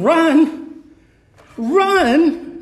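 high, loud stunned "Run?"; incredulous echo. Retold or imitated. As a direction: repeat the word in total disbelief. Stunned echo of mentor's word.

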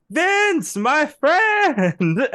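very high, loud, joyful cry; happy Italian director. Retold or imitated. Director running to hug Vince, crying out in high joy. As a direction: call out to your friend with delight. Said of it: "just a happy Italian man".

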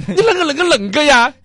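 重庆口音; Chongqing accent. Retold or imitated. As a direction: tack on the Chongqing tag, wound up. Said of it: "后边都会接一句".

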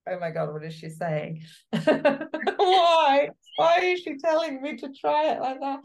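high, loud, laughing mock disbelief; playing a bewildered actor, half laughing; baffled actor.

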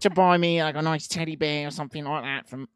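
lightly put-on British accent, whiny; mock a British complainer lightly in a British accent. Mocking a disappointed British wife, mild British accent. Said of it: "I don't know why this person's British".

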